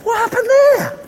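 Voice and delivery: high, startled cry; mock alarm. Hiding farmer notices his food gone, high startled cry. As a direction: jump as if robbed.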